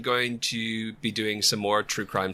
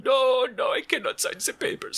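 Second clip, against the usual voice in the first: much higher, squeaky sketch character; high comic voice; 14 semitones higher than usual. Pretend. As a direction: play the reluctant one in a high silly voice. Cheech and Chong sketch voices.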